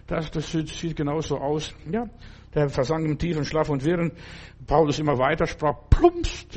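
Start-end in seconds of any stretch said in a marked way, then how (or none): 5.9-6.6 plumpst popped out much higher and louder; sound-effect plumpst. Acted. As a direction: throw in a sudden comic plumpst like a fall. Boy falls from window.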